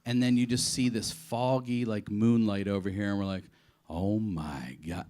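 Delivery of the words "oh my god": slow, low awe-struck murmur; awed gasp. Retold or imitated. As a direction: breathe it out in dread. Seeing the monstrous statue.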